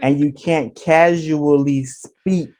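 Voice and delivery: slow, deliberate enunciation; careful enunciation. Shown as a demonstration. Explaining phone talk to listeners who won't understand, carefully enunciated. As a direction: spell out each word slowly and completely. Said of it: "you have to enunciate it completely".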